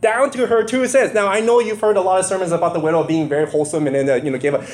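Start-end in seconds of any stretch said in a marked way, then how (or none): none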